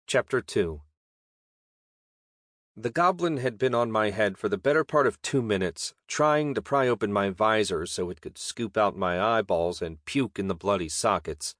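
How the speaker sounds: ordinary delivery, nothing marked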